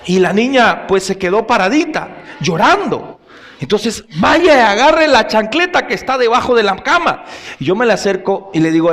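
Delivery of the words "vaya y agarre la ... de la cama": put-on higher, louder repeated command to the little girl; stern mother order. Mother orders her little girl to fetch the slipper, stern and raised. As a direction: bark the order again like an impatient mom.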